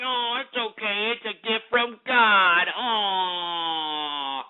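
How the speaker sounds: mocking, put-on cooing pretence; mock approval. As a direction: play at gushing over it, ending on a drawn-out oh. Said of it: "we were just supposed to pretend".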